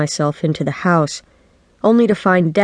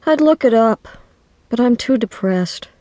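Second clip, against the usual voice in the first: put-on higher, listless girl's complaint; depressed friend; 6 semitones higher than usual.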